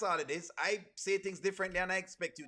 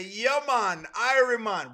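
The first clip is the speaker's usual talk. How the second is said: loud, exaggerated fake Jamaican; mock Jamaican accent; 4 semitones higher, 9 dB louder, slower than usual. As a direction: imitate people teasing with a put-on Jamaican accent. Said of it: "people trouble O'Neal all the time and say".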